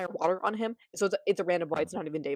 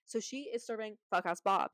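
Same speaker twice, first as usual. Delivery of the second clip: ordinary talk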